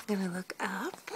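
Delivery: hushed, as if sharing a secret; whisper. Real, not acted. Mother whispers to her baby while looking up, softly.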